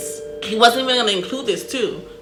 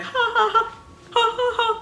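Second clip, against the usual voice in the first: mock laugh. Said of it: "like, a person like".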